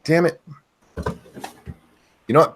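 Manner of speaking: ordinary talk, nothing marked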